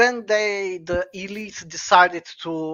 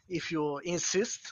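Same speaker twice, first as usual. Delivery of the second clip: ordinary talk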